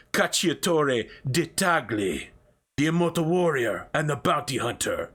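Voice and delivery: hammed-up announcer selling the big film title; trailer voiceover. Voiceover announces the spin-off movie, hammed-up trailer announcer. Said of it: "and the voiceover goes".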